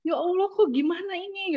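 high-pitched helpless plea; retold distress. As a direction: act out being at a loss. Retold distress at being judged.

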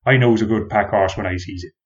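lightly voiced country farmer, rolling and friendly; a hint of an old farmer admiring a horse; rustic farmer. A narrator's reading. Farmer praising the horse to the traveller, lightly rustic. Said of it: "he said".

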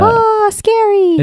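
high, drawn-out pretend fright; mock spooky. Co-host teasing about a dead-body scene, mock spooky. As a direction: coo it slowly like a playful ghost.

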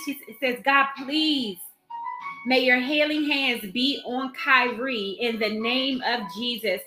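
ordinary delivery

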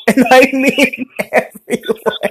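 high-pitched, laughing through the words. Real, not acted. Host telling friends about flying confetti, laughing. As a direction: giggle it out as you speak. Giggling over confetti mishap.

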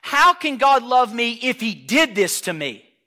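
loud, high, slow protest; anguished accusation. Acted. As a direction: draw out the accusation against God. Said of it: "let's take it up a notch".

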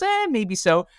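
imitating a serene farmer; unbothered shrug. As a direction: shrug it off, nothing surprises him.

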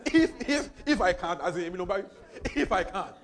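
laughing. Retold or imitated. Mocks the doubter's "if.

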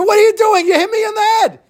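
very high, loud indignant cry; outraged shriek. Acted. Judge yells at Eliezer who hit him, outraged. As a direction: shriek at whoever just hit you.

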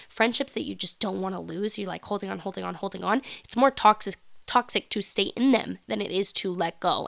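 ordinary delivery

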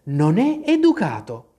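mildly stern scolding; a touch of firm reproach; severe tone. A narrator's reading. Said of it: "con tono severo".

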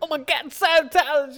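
high-pitched celebrity caricature; hammed-up mock star voice; John Travolta. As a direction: pitch it way up as a silly impression. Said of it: "I'm John Travolta".

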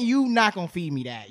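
normal talking voice

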